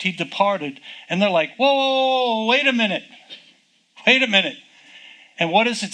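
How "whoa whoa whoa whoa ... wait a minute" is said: higher-pitched urgent whoa; mock urgent protest; calling after someone. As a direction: call out to stop someone leaving. Acts out blind men calling after Jesus.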